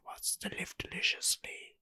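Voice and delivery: soft, voiceless whisper; whispered film quote. Retold or imitated. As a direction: whisper it like a sinister temptation. Quoting the film's whispered temptation.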